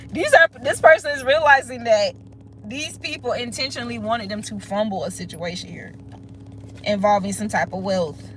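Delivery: ordinary delivery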